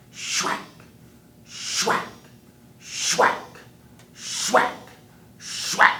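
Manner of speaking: chopping sound. Acted. Wiley chopping cane, building whack sounds. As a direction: build each whack harder.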